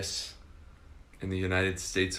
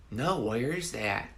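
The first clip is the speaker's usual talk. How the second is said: put-on slow, higher voice of a clueless girl; retold baffled girl; 4 semitones higher, slower than usual. Alabama girl asks where Oklahoma is, slow mock bafflement. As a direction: imitate her drawn-out, puzzled question. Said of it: "and she said".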